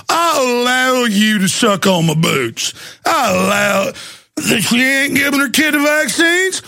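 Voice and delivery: mock southern official ranting about no laws; mock southern accent. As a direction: shout it like a power-drunk southern boss. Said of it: "I'm actually going in my real accent now".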